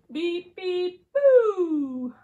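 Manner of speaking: high, loud beeps into a big boo; beep, then burst out a long playful boo; truck horn boo. Acted. Little Blue surprises friends as a ghost, loud high boo. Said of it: "Little Blue Truck says".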